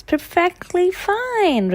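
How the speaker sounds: high, prim fish voice; distinct character voice. Acted. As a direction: give the fish its own voice. Curtis voiced, different timbre.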